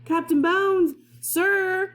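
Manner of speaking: put-on a boy calling up to the captain; shouted call. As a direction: yell for the captain from the cabin window. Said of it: "he shouted".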